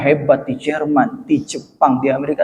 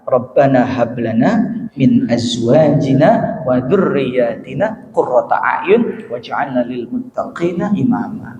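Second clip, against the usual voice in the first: slow, low Arabic verse recitation; Quran recitation; 5 semitones lower, slower than usual. Real, not acted. Preacher quoting the Al-Quran to parents, slow recitation. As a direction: recite the verse slowly, drawing out the syllables.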